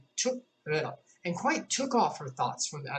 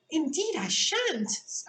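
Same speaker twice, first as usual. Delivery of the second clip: much higher, slow and impatient; impatient Alice; 13 semitones higher, much slower than usual. A narrator's reading. Alice refusing the gentleman, impatient and higher. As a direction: snap it back, put out. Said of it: "Alice said rather impatiently".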